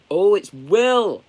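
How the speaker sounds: gasp, then a loud, high "it's Will!"; genuinely thrilled to spot a friend's name; surprised delight. Real, not acted. Sees a friend's comment arrive.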